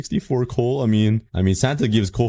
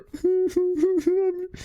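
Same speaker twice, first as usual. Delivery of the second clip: high disbelieving laugh. Real, not acted. Gamer scammed by a villager, high disbelieving laugh.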